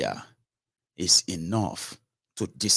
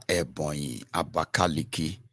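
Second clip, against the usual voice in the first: normal talking voice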